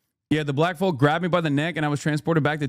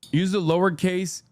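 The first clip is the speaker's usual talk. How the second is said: ordinary talk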